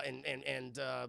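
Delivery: ordinary delivery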